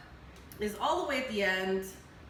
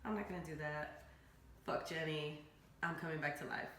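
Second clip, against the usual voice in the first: Tom's evil line, lower and much softer; low villain voice; 5 semitones lower, 11 dB quieter than usual. Retold or imitated. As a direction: say it like a cold, quiet villain.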